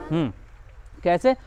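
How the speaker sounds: ordinary talk, nothing marked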